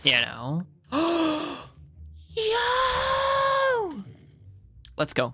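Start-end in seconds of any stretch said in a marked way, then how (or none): 0.9-1.6 a sudden high gasp of surprise; high sharp gasp. Real, not acted. Gamer spotting the mangrove biome, gasping. 2.4-4.0 a very high, thrilled "Yo!"; excited high shout. Real, not acted. Gamer finding the mangrove biome, shouting with joy.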